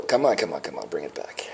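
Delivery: trailing down into a real whisper; self-coaxing under breath. Real, not acted. Vlogger coaxing himself back from tears, fading into a whisper. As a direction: urge yourself on, sinking to a whisper. Urging self to regain composure.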